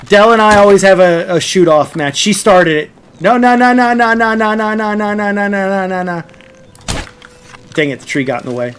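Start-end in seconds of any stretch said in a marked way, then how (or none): 3.2-6.2 panicked no's. Real, not acted. Streamer dodging a friend's arrows, panicked then fading. Panicking while being shot in game.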